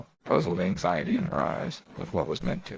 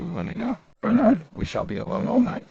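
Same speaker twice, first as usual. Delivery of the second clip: high excited voice; 11 semitones higher, 5 dB louder than usual. Acted.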